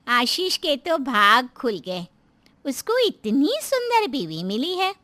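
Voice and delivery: high, drawn-out neighbour woman marvelling; slow, high-pitched amazement; gossiping neighbour. Acted. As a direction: exclaim slowly over his luck, pitch swooping up.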